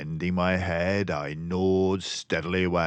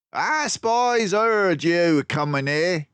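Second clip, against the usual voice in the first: raised, slow gangster voice; gruff villain; 11 semitones higher, 6 dB louder than usual. Acted. Villain sneering at the captured detectives. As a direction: snarl it like a ship thug.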